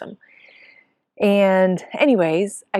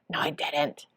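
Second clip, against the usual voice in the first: hushed, whispered denial; whispered disbelief; 3 semitones lower, 7 dB quieter than usual. Retold or imitated. Hearing she placed fourth, whispered in disbelief. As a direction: breathe it out, stunned.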